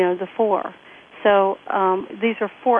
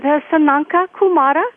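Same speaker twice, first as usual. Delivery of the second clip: higher, louder imitation Indian accent; 7 semitones higher, 6 dB louder than usual. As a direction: say it as the Indian friend, in his accent. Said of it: "Great Indian accent".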